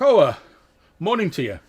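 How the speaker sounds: loud, high-pitched startled fisherman; fisherman calling back. Acted. Fisherman answering a stranger's hail, calling back. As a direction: call back loudly with a swooping greeting. GM voices fisherman hailed from afar.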